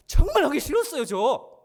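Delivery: high, loud outburst; emphatic protest. Real, not acted. Preacher confessing to congregation he hated seminary, emphatic protest. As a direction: burst out loudly in protest.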